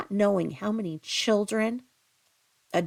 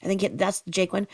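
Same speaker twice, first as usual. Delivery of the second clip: ordinary delivery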